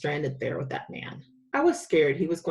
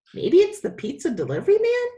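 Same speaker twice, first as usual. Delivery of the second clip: much higher and louder, bursting with hope; 12 semitones higher, 5 dB louder than usual. A narrator's reading. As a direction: blurt it out eagerly, hoping for pizza. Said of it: "I said with high hopes".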